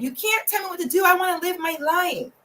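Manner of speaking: high-pitched mock teen outburst; rebellious daughter. Good girl gone bad telling off mom and dad, high mock defiance. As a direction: act out a daughter defying her parents.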